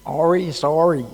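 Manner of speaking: mock North English accent, the h dropped; strong North English accent. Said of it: "strong accent".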